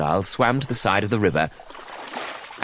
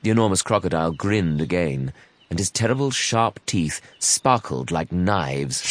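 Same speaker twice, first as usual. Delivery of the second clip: plain reading voice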